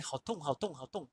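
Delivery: Mandarin 好痛 said softly, fading; Mandarin demo. Shown as a demonstration. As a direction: say 好痛 like it really hurts. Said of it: "usted dice".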